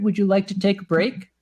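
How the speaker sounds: usual speaking voice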